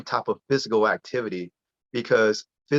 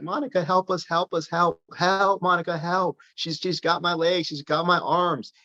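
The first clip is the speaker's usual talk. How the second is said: higher, hammed-up pleas for help, playing trapped; mock cries for help; 7 semitones higher than usual. Dad to daughter in pretend tentacle game, playful higher mock cries for help. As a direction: pretend the tentacles have you and beg Monica to save you.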